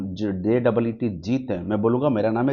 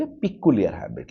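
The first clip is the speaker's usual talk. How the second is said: peculiar in an Indian accent, slow and lower; 6 semitones lower, much slower than usual. Shown as a demonstration. Teacher modelling Indian-accent reading of peculiar. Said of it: "So peculiar हम लोग Indian accent में चलेंगे".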